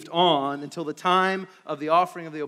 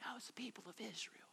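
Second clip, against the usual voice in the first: fast unvoiced whisper; urgent hushed whisper; whispered reveal; 4 semitones higher, 26 dB quieter, faster than usual. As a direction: lean in and whisper the truth. Whispered point.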